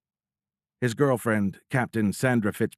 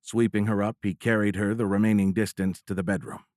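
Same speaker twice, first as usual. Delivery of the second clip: plain reading voice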